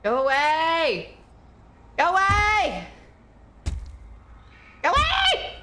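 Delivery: from firm to a shrill high yell; shooing. Real, not acted. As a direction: shoo it off, rising each time. Shooing a troll away.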